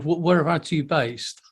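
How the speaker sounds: normal talking voice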